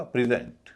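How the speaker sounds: Present with the second syllable stressed, said pri-ZENT; pri-ZENT, second syllable strong. Shown as a demonstration. Teacher demonstrates the verb form, second syllable stressed. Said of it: "दूसरे पर stress है".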